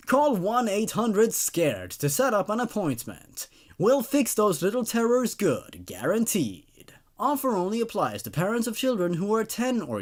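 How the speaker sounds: steady storyteller reading a spooky ad; story narration. A narrator's reading. Narrator reading a creepypasta to listeners, deadpan ad style. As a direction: read it like a creepy infomercial.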